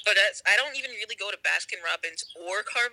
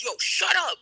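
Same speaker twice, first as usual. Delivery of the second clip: mock scolding; 10 semitones higher than usual. Acts dentist snapping at crying child.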